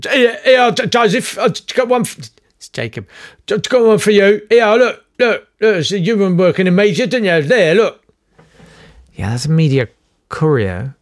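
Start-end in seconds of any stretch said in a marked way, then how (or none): 0.0-2.2 jab at him like an agitated know-it-all; eager angry shout. Retold or imitated. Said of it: "and very angry". 3.5-8.0 much higher, louder, urgent barking; pushy jobseeker blurting high and loud; eager angry shout. Retold or imitated. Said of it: "and very angry".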